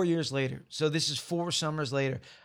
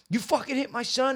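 angry dad confronting; 10 semitones higher than usual. Retold or imitated.

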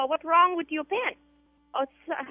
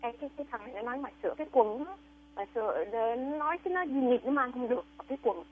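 fast muttered Vietnamese; 3 semitones lower, 7 dB quieter, much faster than usual. Acted.

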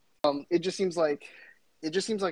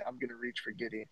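much softer, hushed quote; retold whisper; 2 semitones lower, 12 dB quieter than usual. Friend retelling a secret draft plan, imitated whisper. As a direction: drop the voice to imitate a confided secret. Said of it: "he just whispered to me".

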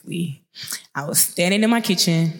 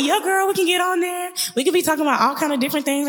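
much higher, racing friend's answer; friend's eager voice; 11 semitones higher, faster than usual. Retold or imitated. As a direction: gush back, fast and keen. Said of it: "She was like".

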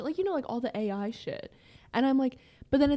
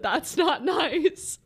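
very slow, higher mock assistant voice, laughing; imitating Siri; 6 semitones higher, 5 dB louder, much slower than usual. Retelling Siri's reply to the insult, laughing. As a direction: play the offended phone, drawn out. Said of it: "and she said".

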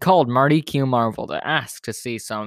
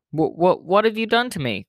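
stammering, much higher, shocked croak; 6 semitones higher than usual. Acted. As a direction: stutter it out, voice jumping up in alarm. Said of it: "croaked Mister Bundy".

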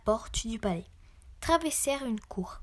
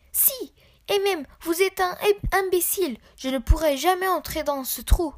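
high, loud indignant protest; indignant insult; 6 semitones higher, 5 dB louder than usual. A narrator's reading. Mr. Malpoli argues with the goblin, indignant. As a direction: protest loudly, pitched up.

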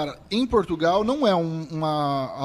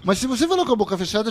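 normal talking voice

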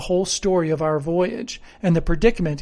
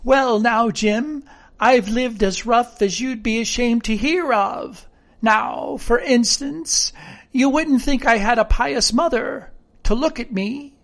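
higher-pitched rustic sailor; castaway, keen and pitched up; eager castaway character; 6 semitones higher than usual. Acted. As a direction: quiz a new mate in sailor talk.

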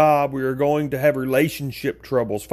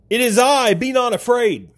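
raised-pitch call of Jesus; calling out reassurance; 8 semitones higher than usual. Retold or imitated. Jesus calls out to assure his frightened disciples, retold as a higher reassuring call. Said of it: "Jesus called out to assure them".

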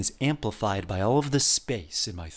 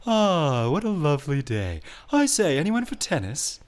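dark yawny tone; 5 semitones higher than usual. Shown as a demonstration. Teacher demonstrating a dark, yawn-shaped voice. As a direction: open the throat like a yawn, hammed up. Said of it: "more like a yawn sound".

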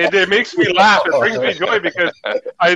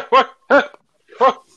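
startled dad waking; 6 semitones higher than usual. Retold or imitated. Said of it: "what Dad would always say".

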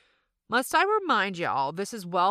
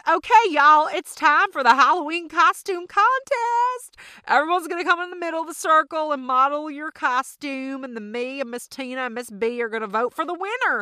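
much higher and louder, announcing; a mom calling the kids into a costume contest; party host mom; 10 semitones higher, 9 dB louder than usual. Retold or imitated. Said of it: "And she says".

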